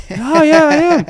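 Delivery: high, loud squeaky yes; mock squeaky reply. Retelling her own nervous reply to grandma, squeaky and high. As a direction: answer in a tiny high voice.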